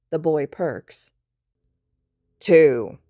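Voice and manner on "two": loud, clipped count; sharp bark. Acted. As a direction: bark it as a last warning. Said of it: "Aizawa barks sharply".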